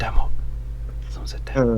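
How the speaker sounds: hushed mock scandal; whispering. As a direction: whisper it like gossip.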